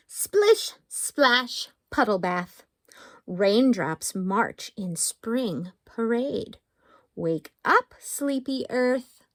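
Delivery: slow, lilting haiku reading; picture-book poetry voice; reading poems to kids. Acted. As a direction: read each haiku playfully to little ones.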